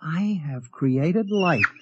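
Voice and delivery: slow, awed declaration; drawn-out, momentous announcement; grand proclamation. Acted. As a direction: proclaim it slowly like a proud creator. Marvels at his own creation.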